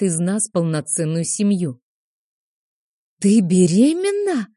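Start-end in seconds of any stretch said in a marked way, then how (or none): 3.2-4.5 high excited squeal; squealing girls. Acted. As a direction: squeal with delight. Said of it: "визжат девчонки".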